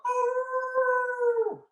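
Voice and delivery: mock wolf howl calling the others; wolf howl imitation. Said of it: "he's going like".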